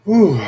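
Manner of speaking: big exhale of relief, high; relieved whew. Real, not acted. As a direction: let out a loud whew of release. Relief after intense moment.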